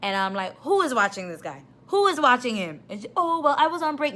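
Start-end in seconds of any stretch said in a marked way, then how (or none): 0.6-2.8 angry demand. Retold or imitated. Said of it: "I went off". 3.1-4.1 mocking excuse.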